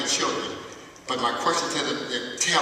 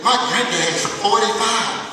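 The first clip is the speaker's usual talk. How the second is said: laughing; 8 semitones higher, 6 dB louder than usual. Retold or imitated. Child's line retold, laughing.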